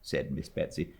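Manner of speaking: plain reading voice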